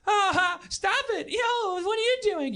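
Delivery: high, hammed-up actor banter; mock actor voice. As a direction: play a cheesy haunted-ride actor. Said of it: "two actors come out and they go".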